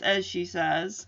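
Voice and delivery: ordinary talk, nothing marked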